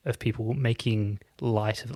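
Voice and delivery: ordinary talk, nothing marked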